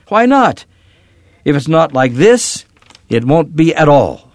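high, loud, defiant retort; angry defiance. A narrator's reading. Daughter defies Papa in a quarrel, angry.

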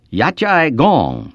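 Yat Chai Gong said slowly and carefully, falling on Chai; teacher slowly modelling Yat Chai Gong for the class, careful Cantonese; Cantonese phrase. A narrator's reading. Missionary teacher drilling students, slow careful Cantonese. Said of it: "she said again".

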